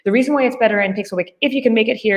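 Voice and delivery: usual speaking voice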